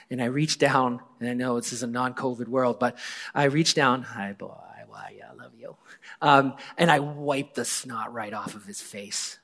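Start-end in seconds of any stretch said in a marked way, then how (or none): 4.0-5.8 soft, low whispered comfort; hushed loving murmur, much softer; tender whisper. Retold or imitated. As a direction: soothe him like a hurting child. Comforting sobbing friend.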